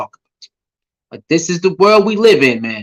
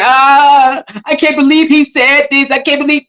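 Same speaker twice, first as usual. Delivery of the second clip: high, whiny fake crying; mock crying; 5 semitones higher than usual. Mocking people upset over what he said, fake crying. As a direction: imitate crybabies with a raised, wailing voice. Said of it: "These other people crying".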